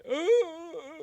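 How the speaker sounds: high, loud mock gasp of pain; imitated gasp. Projectionist stabbed in the chest, acted high gasp. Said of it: "He just, like, gasps".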